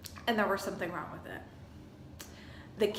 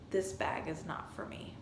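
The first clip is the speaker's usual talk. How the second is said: hushed declaration; 4 semitones lower, 8 dB quieter than usual. Retold or imitated. Telling husband in the car.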